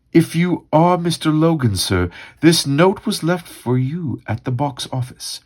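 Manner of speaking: put-on high, lilting British accent; British-accented usher, high and formal. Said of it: "Should I read it again with a British accent".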